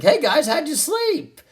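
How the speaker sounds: very high, sunny morning greeting; cheery dad. Retold or imitated. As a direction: chirp it like nothing happened. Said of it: "all cheery".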